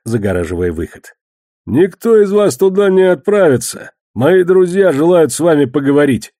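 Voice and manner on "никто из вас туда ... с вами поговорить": raised, loud voice blocking the way; commanding announcement. A narrator's reading. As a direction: declare it high and forceful. Moneylender blocks the exit. (властно, громко, повышенным голосом)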